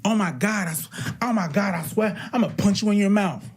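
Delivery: imitating girls' threats. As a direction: act out a girl swearing she'll hit someone. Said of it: "girls do the same shit".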